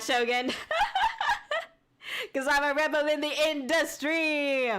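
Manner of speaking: laughing through the words; giggling while repeating the line; breaking into laughter mid-sentence. Real, not acted. Laughing while reading chat.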